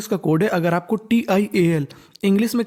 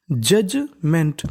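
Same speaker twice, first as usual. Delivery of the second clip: Judgement drawn out very slowly, each syllable separate; teacher sounding out judgement piece by piece; 2 semitones lower, much slower than usual. Shown as a demonstration. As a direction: spell out judgement slowly for students to copy. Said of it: "teacher models pronunciation".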